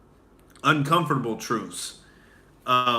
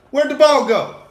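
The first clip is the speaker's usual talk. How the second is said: high, loud startled cry; confused yell; 14 semitones higher, 10 dB louder than usual. Retold or imitated. Fielding a punt, suddenly lost the ball, loud high cry. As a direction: blurt it out in shock.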